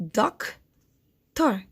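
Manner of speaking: "doctor" as "doc ... tor", long gap between; doctor, one syllable at a time; split into syllables. Shown as a demonstration. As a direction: break doctor slowly in two. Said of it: "to find the syllables".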